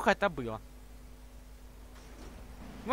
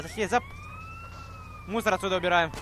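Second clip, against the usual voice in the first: usual speaking voice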